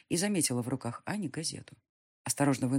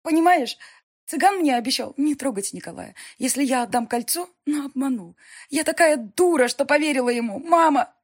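higher, louder hysterical confession; hysterical crying; 9 semitones higher, 8 dB louder than usual. Acted. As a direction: sob it out in hysterics. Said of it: "случилась истерика".

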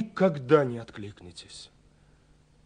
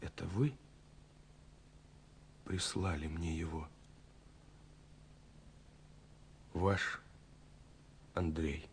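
hushed; 6 semitones lower, 14 dB quieter than usual. Acted. Shy question closing the letter.

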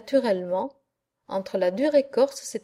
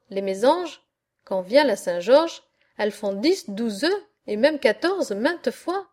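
ordinary delivery, nothing marked

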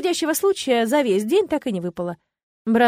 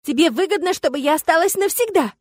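raised, high-pitched accusation; heated outburst, voice pitched up; accusing exclamation; 7 semitones higher, 4 dB louder than usual. A narrator's reading. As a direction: throw the accusation at him. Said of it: "воскликнула я".

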